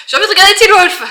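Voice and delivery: high, loud eager inner voice; excited craving. Retold or imitated. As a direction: blurt it out like a sudden craving. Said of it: "je sens qu'en ce moment".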